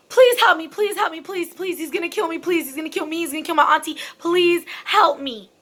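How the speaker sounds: high, loud panicked begging for help; frantic pleading scream. Retold or imitated. Retold woman bursting into laundry room, screaming for help. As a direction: beg for your life, terrified. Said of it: "screaming like a banshee".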